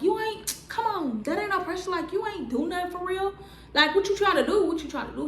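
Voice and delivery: high-pitched taunting; mock taunt. Taunting boyfriend after getting up, high mock taunt. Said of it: "I get back up like".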